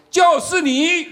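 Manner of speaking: 高声点名，像指挥官在台上一指; 突然提高音量，指着人喊出来; commander's call. Retold or imitated. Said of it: "指挥官突然说". (指挥官在台上当众点名，大声喊出)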